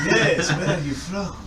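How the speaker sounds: laughing through a Scottish brogue; gruff dwarf with Scottish lilt; Scottish dwarf accent. Acted. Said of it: "has a Scottish accent like all dwarves".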